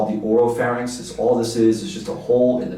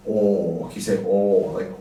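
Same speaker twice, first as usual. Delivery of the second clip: ordinary talk, nothing marked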